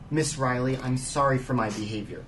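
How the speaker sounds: soft, subdued voice; contrite apology. Acted. Investigator apologising to a witness, contrite apology. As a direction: apologise quietly, slowing on the request.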